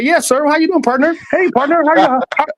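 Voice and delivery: butter up the boss, eager and polite; code-switching politeness. Retold or imitated.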